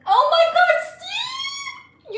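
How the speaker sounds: high, loud cry of delight breaking out; overwhelmed squeal. Real, not acted. Streamer thanking Steve for donating, near-tearful squeal. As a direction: shriek his name, moved to tears. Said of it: "I'm gonna fucking cry on air".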